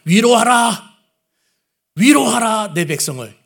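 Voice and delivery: 위로하라 said twice, high and loud; 위로하라 repeated loudly as the emphasis; emphatic repetition. Shown as a demonstration. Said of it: "한 번 해도 강조인데 두 번을 하는 것은 진짜 강조하는 거죠".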